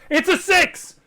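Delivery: cheering at a dice roll; excited shout. Real, not acted. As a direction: yell it out in triumph.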